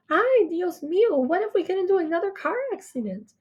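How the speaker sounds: high, anxious cry of worry; fretting, high and fast; worried mother. Acted. Mommy panics at ambulance ride.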